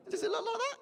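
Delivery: very high, silly taunting voice; mock teasing. Comic mocks his friend's new shirt, high teasing voice. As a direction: jump right up high to take the piss. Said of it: "he took the piss out of me".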